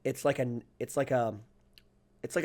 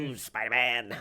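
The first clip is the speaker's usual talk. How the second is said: Green Goblin voice. Retold or imitated. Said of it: "Willem Dafoe from the Green Goblin".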